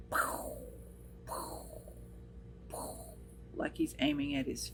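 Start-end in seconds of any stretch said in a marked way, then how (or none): 0.1-3.1 breathy mouthed pow pow pow, much softer; mock gunshots under the breath; whispered gun noises. Husband miming shots at his feet while she cries, whispered pow sounds.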